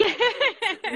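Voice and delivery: high, loud embarrassed laugh; real burst of laughter. Real, not acted. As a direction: laugh it off, caught off guard. Sister calls during live. (rire gêné, haut et fort)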